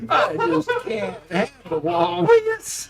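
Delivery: hammed-up Southern drawl, slow in places. Streamer forced by chat into a Southern drawl. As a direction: ham up a folksy Southern accent. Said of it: "You mean I gotta talk like this the whole time?".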